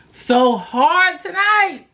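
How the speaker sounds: hyped. Real, not acted. Hyping the party night.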